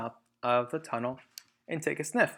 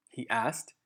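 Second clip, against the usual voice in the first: ordinary delivery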